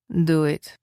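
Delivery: put-on lightly Russian-accented command; a hint of a Russian woman giving an order. A narrator's reading. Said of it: "the woman's Russian accented voice ordered".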